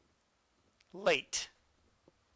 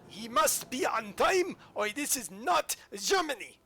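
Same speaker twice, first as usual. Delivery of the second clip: high, slow, stiff mock German official; mock stern German; 7 semitones higher, 4 dB louder than usual. Player mocking German timetables, a stiff pompous official voice. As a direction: play a pompous punctual German. Joking about German punctuality.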